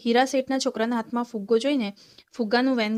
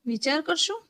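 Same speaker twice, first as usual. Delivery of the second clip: higher, questioning in disbelief; incredulous echo; 5 semitones higher than usual. A narrator's reading. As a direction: throw the words back, stunned. Father echoes son in disbelief.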